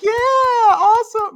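loud, high, drawn-out crowd cheer; whooping cheer. Acted. Girls cheering Brittany's topless pledge, whooping. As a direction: cheer it out like a thrilled audience.

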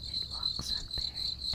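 slow, hushed, half-whispered dread; eerie horror narration. A narrator's reading. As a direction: draw the listener into the dark pond, slowly. Eerie horror story narration opening.

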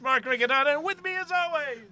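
high, loud host intro; announcer voice. Acted. Host announcing himself to the listeners, booming.

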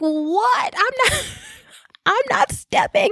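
very high, incredulous squeal breaking into laughter; retold disbelief. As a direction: squeal in mock outrage, cracking up. Retells baffled reaction to Fitbit message.

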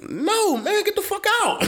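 high, squeaky retold dismissal with a laugh; mock shout-off. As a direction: shoo him out in a high mock yell. Telling drunk customer off.

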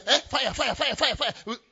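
high, loud cries of fire; panicked fire alarm. Acted. Preacher acting a believer who smells fire, alarmed. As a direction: shout the alarm like the house is burning.

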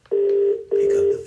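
high, slow pleading "Pick up the phone"; pleading sing-song. Real, not acted. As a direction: coax the phone to answer, stretching each word. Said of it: "Come on, Bernie".

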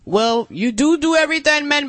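high, loud mock Jamaican accent; playful bad Jamaican accent impression. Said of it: "[name] does not do voices".

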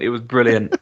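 usual speaking voice

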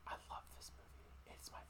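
barely audible, unvoiced whisper. Real, not acted. Fan confessing love for the movie, whispered.

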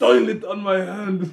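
slow, laughing through the words. Pretend. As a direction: laugh while saying it. Echoes line, laughing.

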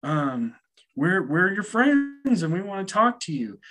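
high-pitched imitation of an eager friend; eager coaxing. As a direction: coax the spirit in a high, friendly voice.

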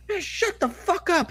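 imitated high, drawn-out angry holler. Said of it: "Just fucking berating him".

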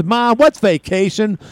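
high, slow southern drawl; drawn-out question in a high southern voice; Forrest Gump. Retold or imitated. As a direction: imitate a simple southern movie character. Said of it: "when Forrest Gump said vacation".

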